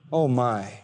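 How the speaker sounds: ordinary talk, nothing marked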